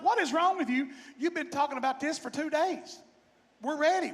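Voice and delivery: high-pitched mock exasperation; retold exasperation. Driver arguing with fiddler at the border, exasperated.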